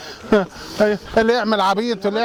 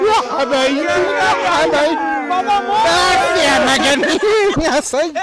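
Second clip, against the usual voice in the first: calling out; 7 semitones higher, 4 dB louder than usual. Real, not acted. Calling out to the group.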